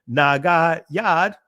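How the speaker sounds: Nagayad said slowly, nah-gah-YAHD, three open ah syllables; ancient Hebrew pronunciation. Shown as a demonstration. Teacher giving the ancient Hebrew form, nah-gah-YAHD. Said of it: "This is the ancient Hebrew".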